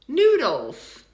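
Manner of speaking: "Noodles" with a loud NOO, falling to weak dulz; reduced second syllable. Shown as a demonstration. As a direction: punch the start of "Noodles", reduce the end to a schwa. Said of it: "It's the second syllable".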